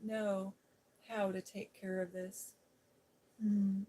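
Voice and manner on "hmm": usual speaking voice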